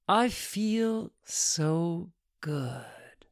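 very slow, drawn-out words; luxuriate, lingering on each word; blissful savouring. Acted. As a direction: stretch every word out in pleasure. She savours her feeling.

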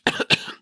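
small throat-clearing cough; polite cough. Acted. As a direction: cough discreetly to object. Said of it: "Michael coughed".

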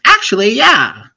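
high, loud mock outburst; imitated eager yes. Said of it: "And she's like".

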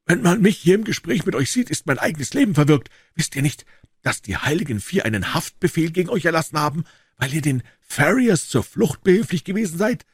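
high, anxious warning voice of a scared man. A narrator's reading. Cowper warns the hunted Jefferson, high and fearful. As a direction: warn him urgently, afraid for your own life.

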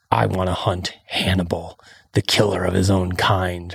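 low, ominous movie-trailer delivery; over-dramatic hunter. Retold or imitated. Host imitating the hunter in the video, low and dramatic. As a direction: drop the voice low for mock drama. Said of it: "getting a little bit dramatic".

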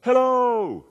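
high drawn-out call across a canyon; yelled out. Acted. Amazed son yells into the Grand Canyon, yelled out. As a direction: holler it high and long into the distance. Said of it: "he yelled out, and he said".

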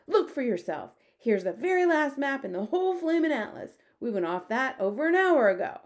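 loud, high, insistent; exasperated cry. A narrator's reading. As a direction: cry it out, fed up. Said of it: "cried the Air Force man".

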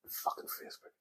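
breathy muttered curse under the breath; voiceless grumble; whispered aside. Real, not acted. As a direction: toss it away in a whisper. Muttered aside about Facebook.

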